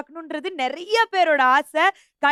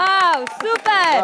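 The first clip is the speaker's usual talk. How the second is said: slow, stretched-out excited praise; cheering; 3 semitones higher, 4 dB louder, much slower than usual. Real, not acted. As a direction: cheer it out for the team. Host cheers the team.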